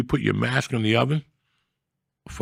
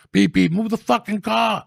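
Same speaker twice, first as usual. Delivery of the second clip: retold yell; 9 semitones higher than usual. Retelling beeping at the cops, mock yell. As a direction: yell it like leaning on the horn. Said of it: "I remember beeping at him".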